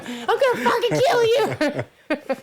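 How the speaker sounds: very high, slow screamed threat; stretched-out furious scream; retold scream. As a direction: scream it through the car window. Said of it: "banging on the window".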